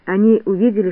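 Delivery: plain reading voice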